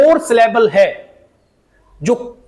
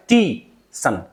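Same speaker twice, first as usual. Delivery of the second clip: stressed "टी", then separate "सन"; TEE, gap, sun, falling; syllable-by-syllable demo; 7 semitones lower, 3 dB quieter, much slower than usual. Shown as a demonstration. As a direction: lean on "टी" and drop on "सन". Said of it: "टे पे आपको stress लगाना".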